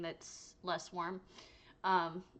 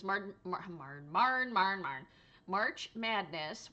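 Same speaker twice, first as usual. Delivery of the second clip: stumbling over words; 3 dB louder, much slower than usual. Real, not acted. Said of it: "words are hard".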